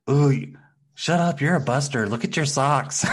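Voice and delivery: high, loud mock taunt; imitated arguing woman. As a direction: act out a comic voiceover insult. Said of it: "she's like".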